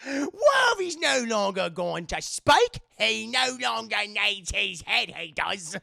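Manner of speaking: slow, loud, badly faked New Zealand accent; Boba Fett. Streamer hamming up Boba's lines, exaggerated New Zealand accent. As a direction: overdo a mock New Zealand accent, drawn out and silly. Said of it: "I can't do the other one".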